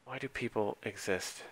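whispered, weary despair; exasperated whisper. Real, not acted. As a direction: sigh it out in disbelief. Exasperated at macro quoting player.

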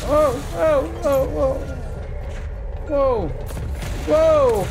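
high, loud alarmed whoa; startled whoa. Real, not acted. Gamer startled by an attacking monster, loud whoas. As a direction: yelp in surprise.

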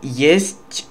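ordinary delivery

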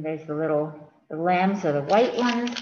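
usual speaking voice

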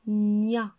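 "Nia" with a long, loud nnn sliding into ya; blended syllable. Shown as a demonstration. Teacher repeats the syllable for children, "Nia" drawn out. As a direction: hold the n of "Nia" before releasing the ya. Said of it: "Répétez".

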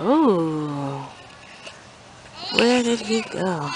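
soft, slow, drawn-out wondering; playful searching. Pretend. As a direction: wonder aloud softly as you search. Searching in hide and seek.

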